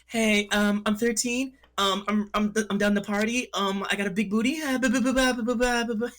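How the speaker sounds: squeaky fake teen girl; man doing a woman's voice. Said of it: "he does a really good girl voice".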